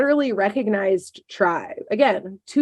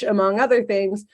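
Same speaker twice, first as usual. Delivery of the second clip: ordinary delivery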